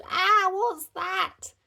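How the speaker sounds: high, slow, startled cry; alarmed yell. Acted. Dad sees something on the track, alarmed yell. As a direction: spot danger on the track. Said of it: "Dad yelled".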